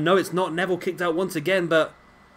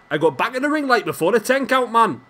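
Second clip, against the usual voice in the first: a fair attempt at a Geordie accent, higher and louder; playful Geordie accent; 7 semitones higher, 6 dB louder than usual. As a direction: Geordie lad boasting, pitched up. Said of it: "That was my best Geordie".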